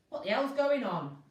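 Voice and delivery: loud furious shout; angry shout. A narrator's reading. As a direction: bellow down the intercom. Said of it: "shouted the ticket inspector".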